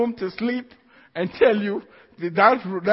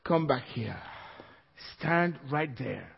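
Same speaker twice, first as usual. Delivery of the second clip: commanding; 6 semitones lower, 8 dB quieter than usual. Retold or imitated. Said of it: "I got up and I said".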